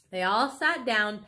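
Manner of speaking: plain reading voice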